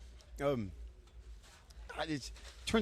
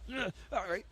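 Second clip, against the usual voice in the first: pained noises; 9 semitones higher, 8 dB quieter than usual. Retold or imitated. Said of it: "You can hear me making terrible noises".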